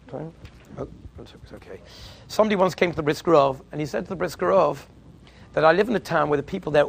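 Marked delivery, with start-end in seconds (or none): 0.1-1.8 breathy unvoiced aside off mic; whispered aside. Real, not acted. Whispering an aside to the organizer about the time. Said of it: "aside about time".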